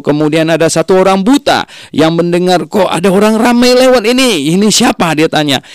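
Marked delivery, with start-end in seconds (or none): 2.7-5.1 blind man asking. Acted. Blind man asks who passes.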